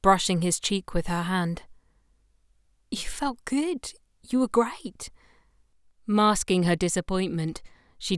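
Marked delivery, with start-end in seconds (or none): 2.9-5.1 higher, gently reassuring. Acted. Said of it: "She comforted him".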